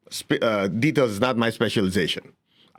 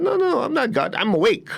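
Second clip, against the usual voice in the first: much higher, light correcting voice; gentle Buddha; 10 semitones higher than usual. Retold or imitated. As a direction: kindly set the worshipper straight. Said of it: "He said".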